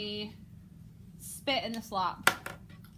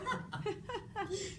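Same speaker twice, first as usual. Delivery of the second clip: scornful laugh; 8 semitones higher, 6 dB quieter than usual. Shown as a demonstration. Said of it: "looking up and down like".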